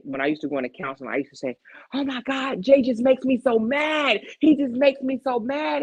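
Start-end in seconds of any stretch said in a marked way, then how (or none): 1.9-5.8 high, whining complaint about Jay; retelling to a counselor how Jay made her mad, high and whiny; mock whiny younger self. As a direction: whine it like a frustrated younger self.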